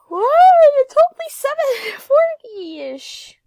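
loud, high amazed whoop; excited outburst. Real, not acted. Gamer amazed at her time to level eight, excited outburst. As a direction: burst out loud and high, stretching the words.

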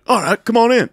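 high, loud invitation; acted gruff welcome. Playing Goggins letting a visitor in, loud. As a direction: call it out to a visitor at the door. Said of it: "And he's like".

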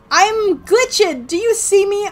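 high, loud and thrilled; excited. Real, not acted. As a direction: blurt it out in delight. Surprised at glitch.

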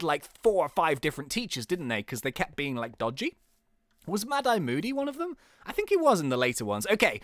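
normal talking voice

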